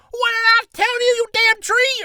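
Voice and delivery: very high, very loud angry outburst; deafening yell. Acted. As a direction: scream it as a mad old man at a tree. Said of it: "I may have deafened you".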